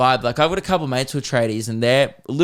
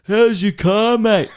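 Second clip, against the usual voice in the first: higher, louder, slow teasing sing-song; mocking tradies; 8 semitones higher, 7 dB louder, much slower than usual. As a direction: rib a mate with mock innocence. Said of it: "we're all going".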